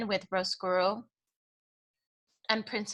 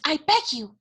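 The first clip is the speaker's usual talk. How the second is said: pleading plea; 7 semitones higher, 3 dB louder than usual. Acted. Louise pleading with Cook.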